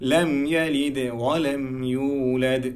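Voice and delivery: slow recitation with qalqala on ইউলাদ; slow Quran recitation. Shown as a demonstration. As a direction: chant লাম ইয়ালিদ ওয়া লাম ইউলাদ slowly, bouncing the final দ. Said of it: "এখানে আমরা কলকলার উচ্চারণটা করব".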